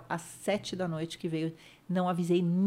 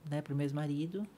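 normal talking voice

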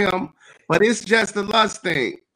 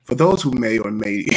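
dramatic read; 10 semitones lower than usual. Shown as a demonstration. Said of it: "listen to how I was gonna read it".

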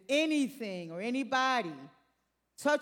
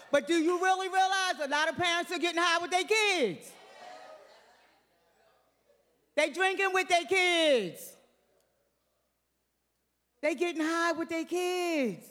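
preaching at full force; 5 semitones higher than usual. Acted. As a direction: hammer "with their kids" each time.

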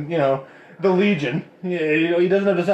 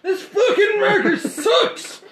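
high, slow laughing complaint; griping at a dead marker through laughter; frustrated laughing outburst; 3 dB louder, slower than usual. Real, not acted. Marker fails mid-game.

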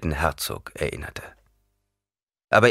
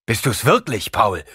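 louder, stunned question; louder, disbelieving; 3 semitones higher, 5 dB louder than usual. A narrator's reading. Gurney recognizes Paul, louder and stunned. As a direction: call out in disbelief. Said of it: "Und dann lauter".

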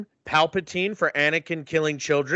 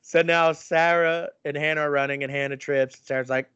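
usual speaking voice

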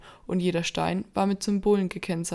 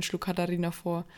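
ordinary delivery

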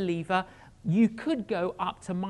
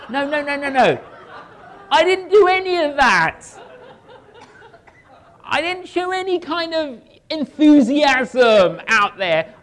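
laughing denial; 7 semitones higher, 12 dB louder than usual. Real, not acted. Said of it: "laughing denial of showiness".

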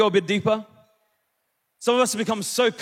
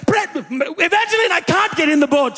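high, spluttering dad impression; exasperated dad; 6 semitones higher than usual. Dad arguing with mum from the water, high acted exasperation. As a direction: act out a flustered husband protesting to his wife.

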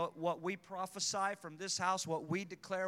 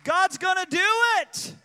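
high, loud final cry; preaching shout; 14 semitones higher, 15 dB louder than usual. Acted. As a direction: shout it out to close the sermon.

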